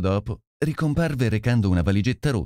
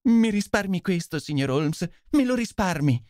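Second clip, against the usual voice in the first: higher, begging voice of a desperate wife; distraught pleading; 9 semitones higher than usual. Acted. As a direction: plead with him not to tell, voice lifted high. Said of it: "lo scongiurò sconvolta e supplichevole". (supplica sconvolta, voce acuta)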